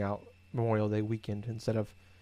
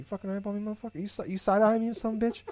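higher, mock-aggressive challenge to the dog; mock tough guy; 9 semitones higher than usual. Owner to her staring dog, mock-tough higher taunt. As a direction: pick a fake fight with your dog, all bluster. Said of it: "I don't know why I said it like that".

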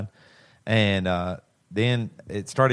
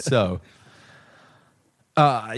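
ordinary delivery

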